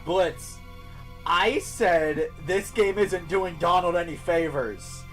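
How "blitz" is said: ordinary delivery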